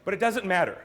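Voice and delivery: usual speaking voice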